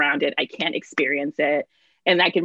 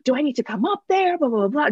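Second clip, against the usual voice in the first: mom mode, higher and anxious; worried mom; 7 semitones higher, 3 dB louder than usual. Retold or imitated. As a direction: play the panicked mom on the phone. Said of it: "And she's like".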